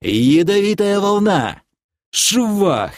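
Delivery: high, drawn-out battle call; spell-casting cry. Acted. Casting at the advancing enemies, spell cry. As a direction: proclaim the spell with force.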